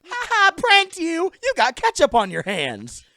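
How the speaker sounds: high, loud, slow mocking toy voice; prank toy voice. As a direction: taunt like a talking prank doll. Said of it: "that voice said everything".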